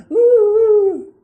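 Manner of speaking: loud, high mocking "ooh"; high-pitched drawn-out "ooh", hammed up; mimicked "ooh". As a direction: imitate him with a long high hoot. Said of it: "And he went".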